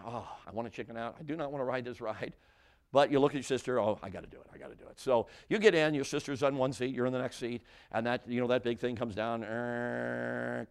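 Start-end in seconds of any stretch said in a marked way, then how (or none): 0.0-2.3 hushed inner voice, softer; quiet dread. Acted. Acted dread before the ride. 3.8-5.0 low, soft inner voice, sinking away; resigned self-talk. Acted. Acted resigned thought beside sister. 9.4-10.7 long droning ride-bar noise; imitated mechanical whir. Imitates safety bar coming down.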